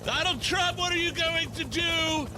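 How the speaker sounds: high-pitched reporter calling over a helicopter; hammed-up press shout with a put-on accent; screaming reporter. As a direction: yell your question up high over the rotors. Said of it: "everybody's just screaming at each other".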